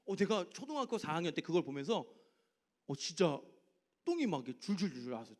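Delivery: ordinary delivery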